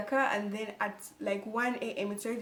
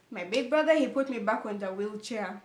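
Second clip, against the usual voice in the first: usual speaking voice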